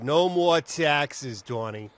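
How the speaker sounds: punched, drawn-out Boston vowels; heavy Boston commercial read; Boston accent. Shown as a demonstration. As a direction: stretch it out in a thick Boston accent. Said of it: "it could be the mayor of Boston".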